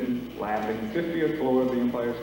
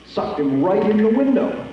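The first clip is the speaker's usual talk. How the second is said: amazed exclaim; 8 semitones higher, 3 dB louder than usual. Acted. Narrating the amazing jump.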